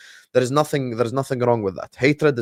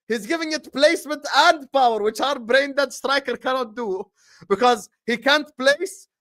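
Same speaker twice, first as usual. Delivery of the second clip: higher all through, fast hype banter; excited mocking pitch; 11 semitones higher than usual. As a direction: sell the trade like a street hustler. High mocking trade pitch voice.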